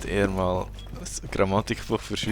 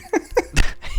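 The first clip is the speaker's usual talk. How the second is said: very high breathy giggle; helpless high-pitched laugh; squeaky laugh; 6 dB louder than usual. Real, not acted. Cracking up again.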